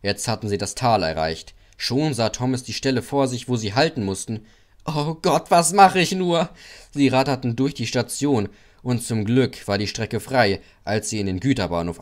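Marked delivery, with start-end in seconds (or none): 4.8-6.5 panic. A narrator's reading. Thomas panicking.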